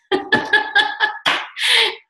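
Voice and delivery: high bursting laugh at her own slip; laughing at herself. Real, not acted. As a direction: break into a sheepish laugh. Laughs at own math mistake.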